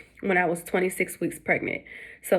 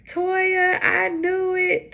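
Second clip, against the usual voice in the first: much higher, slow knowing mom; 11 semitones higher, much slower than usual. Retold or imitated. Mom answering the twin news, high and slow knowing voice. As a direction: say it like a mother who saw it coming.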